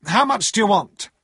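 brusque, sharply voiced demand. A narrator's reading. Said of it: "said Mister Jaggers sharply".

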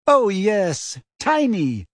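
high, mocking agreement; hysterical sarcasm. A narrator's reading. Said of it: "with a kind of hysterical sarcasm".

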